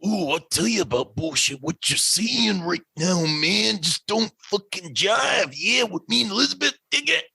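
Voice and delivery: Macho Man impression. Host answering the Stone Cold bit as Macho Man, hammed up. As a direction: ham up Randy Savage, dragging the words then going up. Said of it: "Macho Man".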